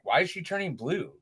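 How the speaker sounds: high, quick worried question; retold alarm. Panicked new father questions doctor.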